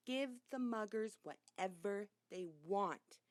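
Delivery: usual speaking voice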